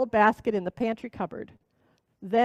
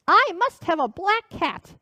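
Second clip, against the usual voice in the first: higher, slow and louder witch demand; insistent witch; 7 semitones higher, 4 dB louder, slower than usual. Acted. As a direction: insist like a stubborn hag. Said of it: "shrieked the witch".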